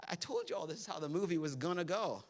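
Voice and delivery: gently calm frightened friends; soothing. Retold or imitated. Jesus calming his scared disciples, soft soothing voice. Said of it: "It's like".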